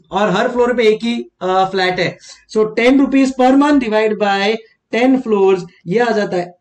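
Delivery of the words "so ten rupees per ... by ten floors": very slow, spelling out the sum; walk the class through the sum slowly; slow explaining. Shown as a demonstration. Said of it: "teacher writing calculation".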